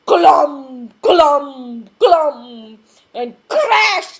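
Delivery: loud, heavy stomping words; stomping footstep sounds. A narrator's reading. Narrator thumping out Mister Noisy's steps loudly. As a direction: stamp each glum out.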